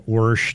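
"worsh" with an r before sh; "worsh" as the regional form of wash. Retold or imitated. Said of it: "a lot of 'em said".

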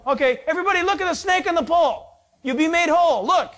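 calling out to the crowd. Acted. Moses calling the bitten people to look at the pole, loud fast call. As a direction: call the whole camp to look up. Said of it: "So tell them".

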